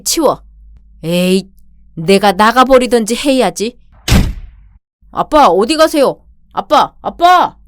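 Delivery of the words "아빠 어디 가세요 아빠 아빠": calling after him. Acted. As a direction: cry out for dad to come back. Daughter calls after leaving father.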